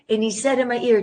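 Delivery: usual speaking voice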